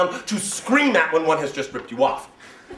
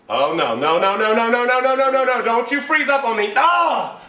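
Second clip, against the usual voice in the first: rising, louder no no no; panicked user; 5 semitones higher, 6 dB louder than usual. Pretend. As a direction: panic as the screen freezes. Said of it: "trying to say".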